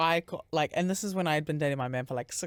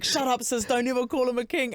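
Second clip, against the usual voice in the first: higher-pitched, emphatic telling-off; mock scolding; 6 semitones higher than usual. Commenters telling her off over the pet name, mock scolding. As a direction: imitate a sassy commenter laying down the law.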